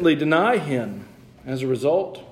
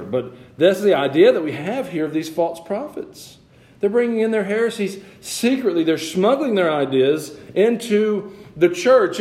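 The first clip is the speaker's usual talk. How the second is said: higher, emphatic pulpit delivery; forceful preaching; 5 semitones higher, 3 dB louder than usual. Acted. Pastor warning church about false prophets, forceful preaching. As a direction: press each point on the congregation.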